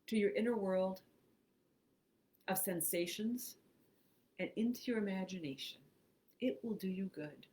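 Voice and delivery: unhurried phrases with long pauses; guided meditation. Acted. Host reads a relaxation script to online listeners, guided meditation. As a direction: guide them through breathing, leaving room after each phrase.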